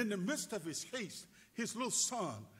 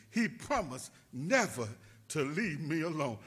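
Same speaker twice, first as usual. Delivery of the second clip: ordinary talk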